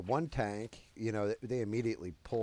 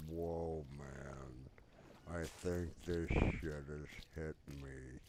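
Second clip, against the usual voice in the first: slow, low, drugged drawl; deep nitrous voice; 4 semitones lower, 5 dB quieter, slower than usual. Retold or imitated. Stoned friend feeling the gas hit, deep slow voice. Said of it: "getting a little bit deeper and deeper".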